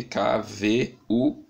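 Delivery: usual speaking voice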